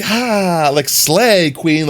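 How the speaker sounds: mock sassy high "slay queen"; lightly camp sales voice; effeminate sass. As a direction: camp it up, playfully. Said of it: "act a little bit more effeminate".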